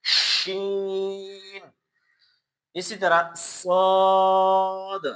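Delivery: شین and صاد drawn out slowly with hiss; whistling letters demo. Shown as a demonstration. Teacher drilling the whistling letters. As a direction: stretch each hissing letter. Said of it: "یہ تین حروف سیٹی والے ہیں".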